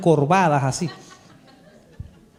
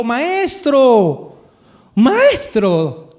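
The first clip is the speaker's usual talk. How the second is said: joyful recognition; 4 semitones higher, 5 dB louder, much slower than usual. Acted. María recognizes risen Jesus.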